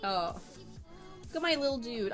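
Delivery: ordinary talk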